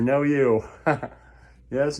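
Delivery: usual speaking voice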